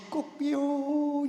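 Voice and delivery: high, long-drawn cock-a-doodle; rooster crow. Retold or imitated. Preacher imitates the rooster Peter heard, in a high drawn-out crow. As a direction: crow it out high, stretching the last syllable. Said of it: "베드로는 닭 우는 소리".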